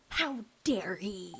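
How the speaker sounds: whispered, slow mock outrage. Vlogger mocking the fiancée's outrage, slow sarcastic whisper. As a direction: hiss it out in sarcastic disbelief.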